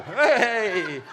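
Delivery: loud, drawn-out showbiz cheer; mock cheer. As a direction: hail it like a big TV moment. Mock cheer about TV appearance.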